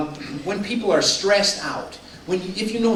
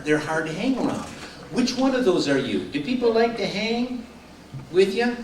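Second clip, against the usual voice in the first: normal talking voice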